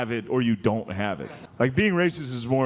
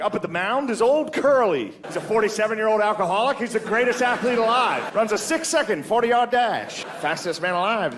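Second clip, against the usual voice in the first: put-on high, old-timey radio announcer voice; 7 semitones higher than usual. Mock announcer opening the ballgame, old-timey radio voice. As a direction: call the game like the forties.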